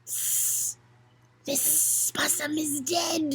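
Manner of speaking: slow hissing snake voice; sly snake hissing; hissed. Acted. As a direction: hiss the words slowly like a snake. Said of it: "it hissed".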